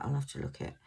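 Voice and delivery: usual speaking voice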